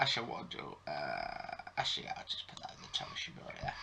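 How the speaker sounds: quick unvoiced mutter to self. Real, not acted. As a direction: whisper it under your breath. Muttering aside while adjusting logo.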